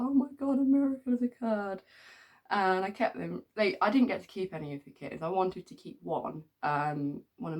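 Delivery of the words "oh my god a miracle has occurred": high, loud mock wonder at a miracle; mock amazement. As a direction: gasp it out like a miracle.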